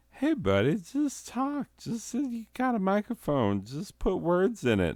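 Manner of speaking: squeaky little egging-on voice; very high, small falsetto coaxing; high-pitched pep talk. Acted. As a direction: pitch it way up, like a tiny imagined cheerleader. Voices own confidence egging him on.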